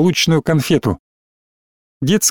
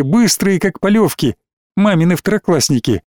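ordinary delivery, nothing marked

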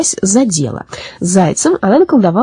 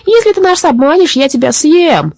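roar; 9 semitones higher than usual. A narrator's reading. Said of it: "заревел людоед".

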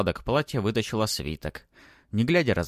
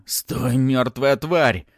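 loud, slow-drawn angry yell; hoarse shout; 5 semitones higher, 7 dB louder, much slower than usual. A narrator's reading. Baron chases the boy, yelling hoarsely. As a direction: strain out a threat at a fugitive. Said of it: "хрипел барон".

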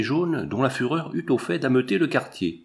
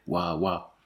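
soft, lower spoken woof; gentle bark; 5 semitones lower, 5 dB quieter than usual. A narrator's reading. Yellow dog reassuring the anxious wife, gentle mock bark. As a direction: lightly imitate a dog barking softly. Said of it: "aboya doucement".

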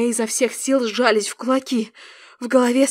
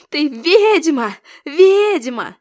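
higher, louder, drawn-out cry; relentless accusation; 4 semitones higher, 6 dB louder, much slower than usual. A narrator's reading. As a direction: hurl the word twice, unable to stop. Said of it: "Не могла остановиться она". (яростное обвинение)

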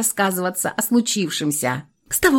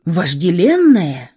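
usual speaking voice

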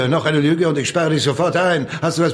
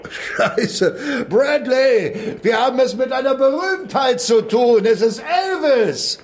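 mocking amusement; 8 semitones higher than usual. Cop mocks the famous name.

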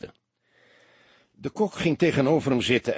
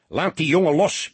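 higher, louder commanding roar; 6 semitones higher, 5 dB louder than usual. A narrator's reading. Senior detective roaring at his young colleague to let go. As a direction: bark the order across the room. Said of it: "brulde hij".

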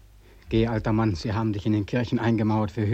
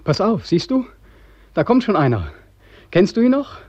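agitated loud; 4 semitones higher, 6 dB louder than usual. Acted. Beckmann points out a passerby.

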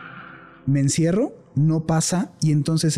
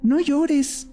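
put-on higher, childlike voice comforting someone; imitating his toddler son; 11 semitones higher than usual. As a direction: play the little boy saying don't cry, higher. Synek pociesza płaczące dziecko.

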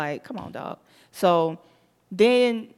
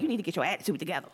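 fast, quiet scolding; a quick, muttered scold; mock scolding; 2 semitones lower, 7 dB quieter, much faster than usual. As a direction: rattle off a scolding. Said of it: "it's like".

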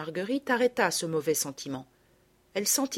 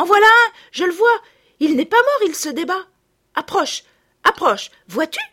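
much higher, much louder shout; a girl shrieking at the pond, excited; excited cry; 9 semitones higher, 12 dB louder than usual. Acted. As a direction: call your friend over urgently. Spotting the hedgehog.

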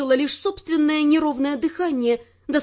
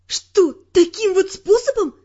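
higher, louder, slow outrage; indignant; 5 semitones higher, 5 dB louder than usual. Acted. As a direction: demand it, outraged and deliberate. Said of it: "возмутилась я".

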